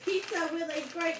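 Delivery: ordinary talk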